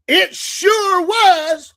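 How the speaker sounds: very slow, high, loud retort; imitated drawn-out emphatic comeback. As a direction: stretch every word out loud and high. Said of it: "he goes".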